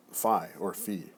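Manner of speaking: normal talking voice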